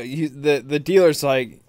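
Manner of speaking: usual speaking voice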